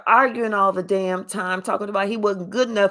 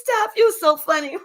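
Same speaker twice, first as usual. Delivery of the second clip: high giggling through the words; giggly flirting.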